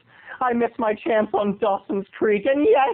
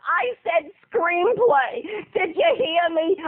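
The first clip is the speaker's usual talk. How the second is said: high, slow, insistent casting agent; pleased-with-herself high agent voice; casting woman; 6 semitones higher, slower than usual. Acted. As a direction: drag it out high, demanding to be heard. Acting out casting woman's joke.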